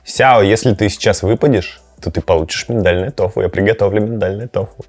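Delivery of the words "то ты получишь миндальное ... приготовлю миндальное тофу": usual speaking voice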